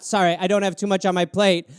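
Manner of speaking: ordinary delivery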